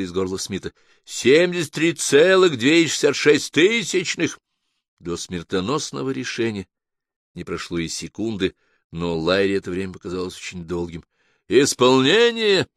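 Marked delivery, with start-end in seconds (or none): 1.0-4.4 loud, high, drawn-out machine announcement; ringing, slow automated call; booming computer. A narrator's reading. The voice announces the score. 11.5-12.7 loud, high, drawn-out machine announcement; ringing, slow automated call; booming computer. A narrator's reading. The voice announces the execution.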